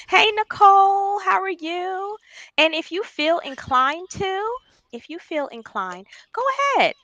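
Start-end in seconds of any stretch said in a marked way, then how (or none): 0.1-2.2 high, bright hello drawn out slowly; excited greeting. Real, not acted. Host greeting Nicole as she joins the live, excited. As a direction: sing out a welcome to a friend arriving.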